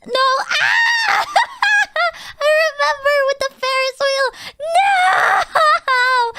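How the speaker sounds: high, loud cringing yelps; embarrassed shriek. Real, not acted. Streamer shrieking at her own blooper. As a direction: squeal at hearing your own blooper.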